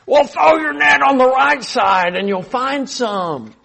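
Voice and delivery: put-on high, slow, loud old man call; meddling old man. Jesus as an old man calls advice to fishermen, loud. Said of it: "not just acting old, but meddling".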